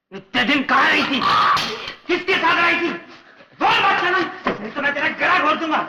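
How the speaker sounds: a jealous rage that keeps building; furious shout. Acted. Mad husband screams threats at his wife in a jealous fury.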